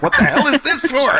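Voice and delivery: high, baffled mock voice; puzzled native. Native women baffled at the Tupperware party, high confused voice. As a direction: squeal it out like a confused tribesman.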